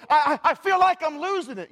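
imitating a frazzled person, hammed-up; panicked complaint. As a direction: stammer like someone overwhelmed. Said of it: "people go".

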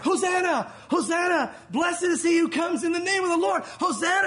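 high, loud jubilant shouting; joyful crowd shout. Retold or imitated. Crowd welcoming Jesus into Jerusalem, shouting with joy. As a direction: cheer it out like a roaring crowd. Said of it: "it's like, happy piano music".

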